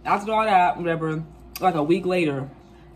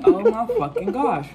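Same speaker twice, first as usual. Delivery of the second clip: shocked; 7 semitones higher, 4 dB louder, slower than usual. Retold or imitated. Shocked at positive test.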